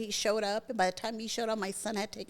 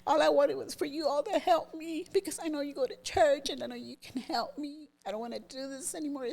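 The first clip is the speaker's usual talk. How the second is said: higher, emotional plea retold; tearful pleading; 6 semitones higher than usual. Son begging his mother for help, tearful. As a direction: plead as if starting to cry. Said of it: "and then he starts crying".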